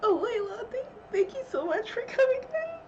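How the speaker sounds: high, tearful thanks; thanking through tears; crying. Real, not acted. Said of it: "I feel like such a mess".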